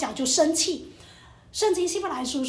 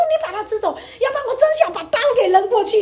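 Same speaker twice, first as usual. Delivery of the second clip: loud, high, fed-up demand; retold exasperated yell; aggrieved outburst; 8 semitones higher, 7 dB louder than usual. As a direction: vent it at your husband, voice rising loud. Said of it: "因为我觉得很委屈啊".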